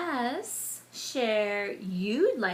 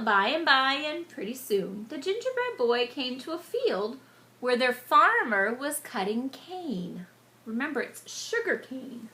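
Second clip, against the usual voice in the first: plain reading voice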